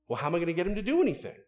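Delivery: mock exasperated wife. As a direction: play the put-upon wife, rushing. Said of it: "Women, don't dishonor your husbands".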